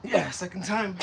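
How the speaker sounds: short phrases pushed out between reps; real exertion, puffing between phrases; out of breath. Real, not acted. Talking while doing burpees.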